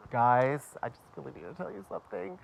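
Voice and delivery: hushed, hammed-up heavy confession; mock emotional confession. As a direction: play the big tearful announcement, half-joking. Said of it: "They wanted the emotional".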